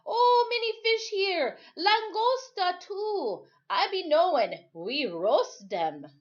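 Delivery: loud, high call, slowed and stretched; shouting up from the water. Acted. Timothy calling up to the boy from the surf, loud and high. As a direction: call happily up the beach about the fish. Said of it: "Timothy shouted up from the water".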